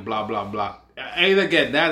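ordinary talk

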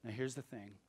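ordinary talk, nothing marked

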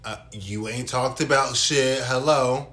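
sassy demanding. Retold or imitated. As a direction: demand answers with sass. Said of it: "be like".

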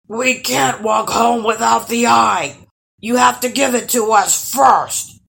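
high, loud troll protest; loud monster begging; pleading troll. A narrator's reading. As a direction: wail it insistently as a troll.

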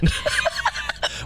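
airy burst of laughter; breathy high laugh. Real, not acted. Laughing at the joke.

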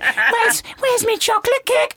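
put-on very high squeaky kid voice; squeaky mock-child whine; demanding child. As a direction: mimic a child piping up for a treat. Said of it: "one of the children".

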